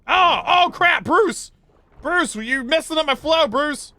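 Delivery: panicked shout at a teammate; excited yelling. Real, not acted. Yelling at Bruce during game.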